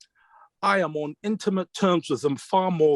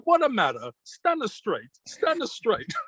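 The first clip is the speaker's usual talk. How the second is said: imitated Italian-accented scolding, climbing higher; 4 semitones higher than usual. Mentor telling student to straighten up on stage, Italian accent. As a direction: mimic an Italian mentor's broken English. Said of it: "With his Italian accent".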